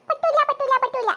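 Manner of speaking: high wailing refusal; tantrum. Acted. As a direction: rattle it out like a child refusing comfort. Boy refuses to accept missing trip.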